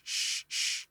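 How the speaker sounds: soft unvoiced sh sh mimicking cymbal; whispered sh imitating the track; imitated hi-hat hiss. Shown as a demonstration. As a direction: breathe out a hissing sh like a hi-hat. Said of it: "It now sounds like a sh".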